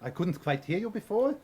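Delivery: language coach's put-on German accent. Coach obliges his student's request, German accent. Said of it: "the German one".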